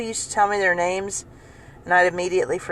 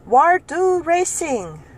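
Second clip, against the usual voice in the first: put-on high, slow, accented broken English; imitating the customer's broken question; 9 semitones higher, 3 dB louder, much slower than usual. Customer asking the cashier for raisins in halting English. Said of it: "didn't know how to ask it in English the right way".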